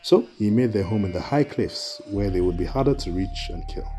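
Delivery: ordinary delivery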